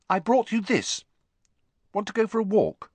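Hermione's voice. A narrator's reading. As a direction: lift the voice into a bright girl.

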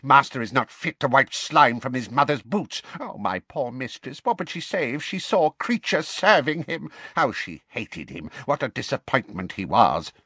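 high, resentful elf lament; grieving servant, spiteful; bitter house-elf. A narrator's reading. House-elf voiced by narrator.

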